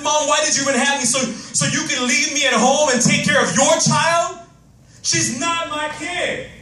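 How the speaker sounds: high, fast shouting at mom; angry shout. Retold or imitated. Kid yelling at mom, furious shout. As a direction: yell it out like a furious child. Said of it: "because I was angry".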